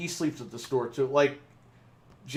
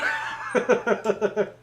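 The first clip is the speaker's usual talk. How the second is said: loud high laughing fit; cracking up; burst of laughter; 8 semitones higher, 4 dB louder than usual. Real, not acted. Laughing at the scared coworker, loud high laughter.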